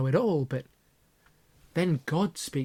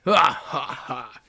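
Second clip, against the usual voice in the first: mock knowing laugh; wise laugh; 4 dB louder, slower than usual. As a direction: chuckle knowingly. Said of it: "Hagrid laughed wisely".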